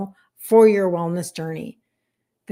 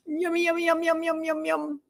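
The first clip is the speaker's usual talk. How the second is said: high, soft sung-out yums; singsong yummy; 8 semitones higher, 5 dB quieter than usual. Real, not acted. Delighting over a viewer's pecan bars, high singsong. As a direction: croon the yums like a treat is coming.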